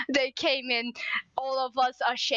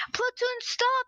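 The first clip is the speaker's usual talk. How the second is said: retold shout; 9 semitones higher than usual. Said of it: "everybody started screaming".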